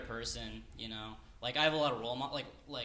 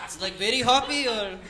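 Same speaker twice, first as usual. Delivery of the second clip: high, loud mock Russian accent; Russian terrorist accent; 8 semitones higher, 8 dB louder than usual. Terrorist quizzes bartender on hops in mock Russian accent. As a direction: ask loudly in a put-on Russian accent. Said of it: "this is a Russian terrorist".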